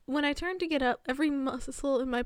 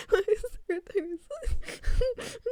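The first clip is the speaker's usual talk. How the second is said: higher, slowed and stretched, laughing; slow laughing repeat; 7 semitones higher, slower than usual. Real, not acted. Streamer repeating the question, slow and giggling. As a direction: draw the question out while giggling.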